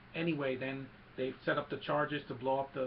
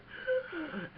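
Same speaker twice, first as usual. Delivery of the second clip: high, acted gasps; mock pained gasping; imitated gasping. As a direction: gasp in a pulsing, hammed-up way. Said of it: "And he's like".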